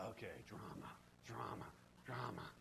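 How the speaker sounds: low, soft, weary; resigned mutter. Retold or imitated. Preacher resigned to the church drama, low mutter. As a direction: sigh it out.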